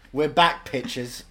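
loud, high showy announcement; hammed-up announcement. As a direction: shout it like a showman. Mock loud pun announcement.

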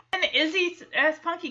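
ordinary delivery

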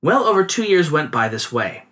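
ordinary delivery

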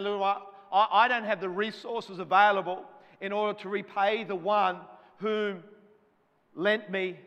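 desperate plea. Retold or imitated. Student prophet explaining his unpayable debt, urgent loud plea. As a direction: beg as if you can't repay. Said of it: "he's saying".

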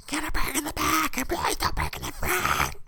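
bossy, bratty cartoon-kid voice pushed very high, then dropping; mock-screaming boss in a cartoon brat voice; female Cartman. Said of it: "That, that's basically how she sounded".

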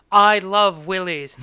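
loud, slow, emphatic repeat; loud mock declaration. He answers her "What?", loudly and slowly imitated. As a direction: proclaim it loudly, drawing it out.

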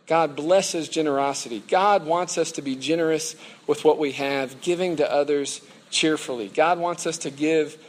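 loud, deliberate sermon delivery; preaching. Real, not acted. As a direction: proclaim the main point to the whole room, loudly. Preaches the closing main point.

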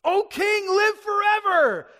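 high, full-voiced shout; exultant call. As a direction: call it out loud, last words drawn out. Daniel calling from the den.

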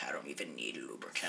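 very soft, low whisper; mock self-aroused whisper. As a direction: whisper it as a private hammed-up confession. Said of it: "he hit send and was like".

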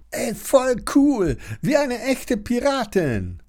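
put-on boy in the bathtub admires his sister, high and gleeful; thrilled little boy. A narrator's reading. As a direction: squeal it like a delighted kid.

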